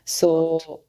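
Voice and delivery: ordinary delivery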